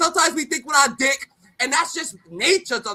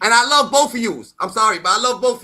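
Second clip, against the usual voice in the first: loud love declaration; 8 dB louder than usual. Real, not acted. Declaring loudly she loves both friends. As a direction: belt out your love for both.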